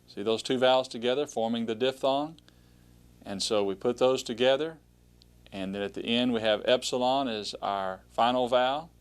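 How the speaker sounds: ordinary delivery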